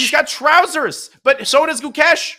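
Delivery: high, loud, stretched-out call; excited play-by-play. Real, not acted. Commentator calling the frantic endgame, excited shouting. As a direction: call the climax at high pitch.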